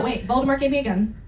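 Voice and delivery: ordinary talk, nothing marked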